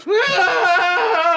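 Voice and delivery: high, loud mock sob-laugh; loud fake crying wail. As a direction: wail out a hammy loud cry. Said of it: "I was just loudly crying".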